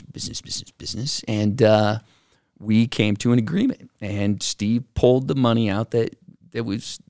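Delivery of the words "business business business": breathy whispered business, business, business; whispered repeat. Retold or imitated. Recalling the partners' catchphrase, whispered. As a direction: mutter it under your breath.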